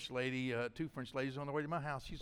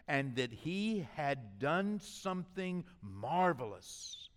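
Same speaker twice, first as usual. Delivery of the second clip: ordinary delivery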